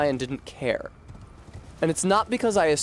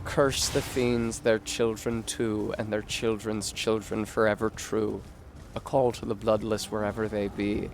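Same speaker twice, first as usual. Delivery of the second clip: ominous curse; 5 semitones lower, 4 dB quieter than usual. Acted. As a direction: intone it like a dark prophecy. Quotes trailer's ominous curse.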